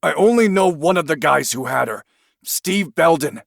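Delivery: high, pleading confession; confess, scared; frightened confession. Acted. Suspect confesses in fear.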